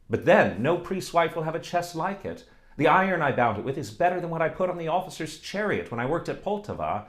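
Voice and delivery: eager smith, proud then coaxing; pleading suitor. Acted. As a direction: win her over, boasting of the chest. Blacksmith woos Oksana.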